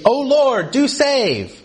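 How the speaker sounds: acted crowd plea, pitched high; calling out. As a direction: call it up to the heavens like a crowd. Crowd's cry from inside walls.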